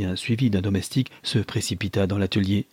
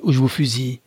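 armed threat; 4 semitones higher, 4 dB louder than usual. A narrator's reading. Guard threatens at gunpoint.

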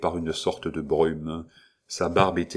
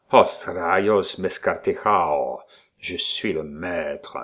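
slow, booming savage victory cry; barbaric triumph; slower than usual. Acted. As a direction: bellow it like a conquering barbarian. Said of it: "ce cri de barbare triomphe".

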